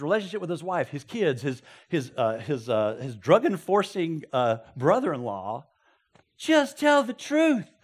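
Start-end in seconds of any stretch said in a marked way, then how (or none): 6.4-7.7 high, slow, loud plea; pleading yell. Retold or imitated. Viewer yelling at the TV character, slow pleading cry. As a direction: beg the character to just come clean. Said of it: "and I yelled".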